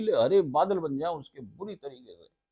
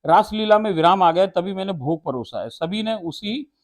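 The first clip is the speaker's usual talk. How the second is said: louder and higher, rapt telling; ecstatic devotional recitation; 3 semitones higher, 7 dB louder than usual. Acted. Preacher describing Radha dancing in the air, rapt and loud. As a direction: carry them up into the dance.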